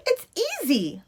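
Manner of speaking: put-on high, light girl's voice for Lucia; Lucia's bright voice. A narrator's reading. Lucia cheerfully answers Punchinello, high light voice. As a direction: lift it up high and easy. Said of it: "Lucia replied".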